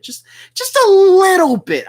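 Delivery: "little bit" blurted very high and loud; exasperated emphasis. Real, not acted. Streamer admitting he had begun respecting a rival, exasperated emphasis. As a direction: stretch "just a little bit" slowly, leaping up in pitch. Bitter repeat for emphasis while ranting.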